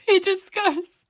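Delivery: ordinary talk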